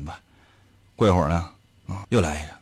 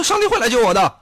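mock pious faith; 9 semitones higher than usual. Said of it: "那我说".

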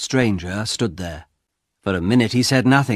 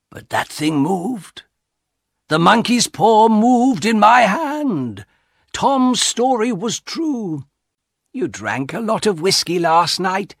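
put-on slow, higher, earnest old husband; lightly voiced uneasy old man; husband's voice; 9 semitones higher, slower than usual. A narrator's reading. Husband voiced in read story.

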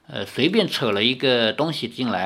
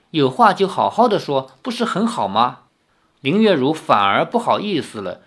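raised, lively storybook reading; story narration; 5 semitones higher, 4 dB louder than usual. A narrator's reading. Narrator reading the quarrel of three young friends aloud. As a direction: read the novel scene aloud with lively voices. Reads novel aloud with voices.

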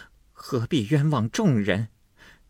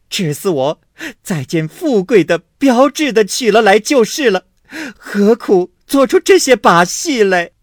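loud, high tearful wailing; wailing and crying out; 10 semitones higher, 9 dB louder than usual. A narrator's reading. Wife wailing accusations at husband, loud and tearful. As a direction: wail your grievances at full voice. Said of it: "一面又哭喊说".

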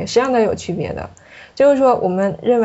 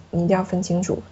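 ordinary talk

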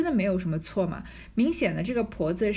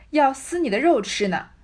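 put-on louder, higher furious old woman; scolding; 5 semitones higher, 5 dB louder than usual. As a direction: yell the threat at her. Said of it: "骂道". (婆子怒气冲冲地大声骂)

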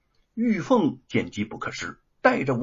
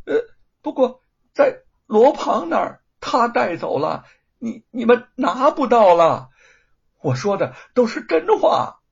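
frightened insistence; 9 semitones higher, 9 dB louder than usual. Acted. Panicked confession continues.

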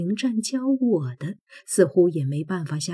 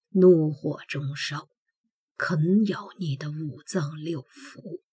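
soft menacing; 5 dB quieter than usual. Acted. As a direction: hush it like a warning. Prince threatens softly. (低声威胁)

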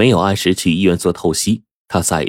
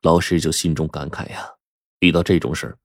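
plain reading voice

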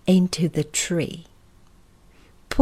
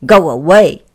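loud, rude dismissal; 3 semitones lower, 10 dB louder than usual. Acted. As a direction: send them off rudely and loudly. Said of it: "said Mister Uppity rudely".

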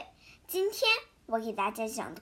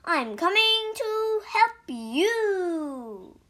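slow, high, louder call; 6 semitones higher, 5 dB louder, slower than usual. A narrator's reading. Toad calling to Frog, slow high louder call. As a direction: reassure Frog from afar, slowly. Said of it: "cried Toad".

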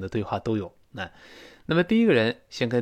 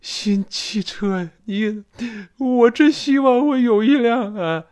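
high, drawn-out envious wailing; theatrical envy, slow and far above usual pitch; dying of envy; 10 semitones higher, 4 dB louder, slower than usual. Acted. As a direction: act it hammed-up, sick with envy, building to a loud wish. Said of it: "第二个人羡慕死了".

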